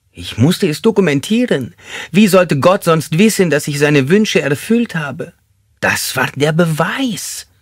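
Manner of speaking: high, fervent justification; fanatic suspect. Acted.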